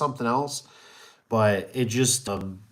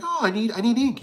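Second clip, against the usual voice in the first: mock realization; 10 semitones higher than usual. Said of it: "and you're like".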